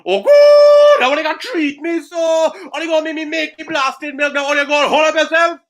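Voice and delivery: very high, wailing Trinidadian tirade; whining Trinidadian accent. Acted. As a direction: erupt as an aggrieved Trini cow, shrieking the complaint. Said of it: "constantly whining and complaining".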